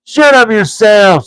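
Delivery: high, loud troll snap; two trolls silencing the third; angry trolls. A narrator's reading. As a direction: bark it at the voice. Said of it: "said Tom".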